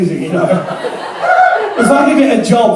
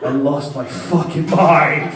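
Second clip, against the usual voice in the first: normal talking voice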